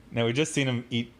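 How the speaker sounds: ordinary delivery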